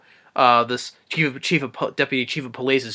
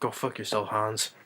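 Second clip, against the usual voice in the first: much softer, low defiant; mock defiant murmur; 3 semitones lower, 11 dB quieter than usual. McClane defying Hans on the radio, soft and defiant. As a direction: throw it away under your breath. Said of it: "John McClane goes".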